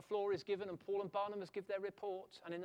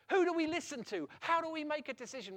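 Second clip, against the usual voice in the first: excited; 9 semitones higher, 8 dB louder than usual. Acted. Apostles' excited questions.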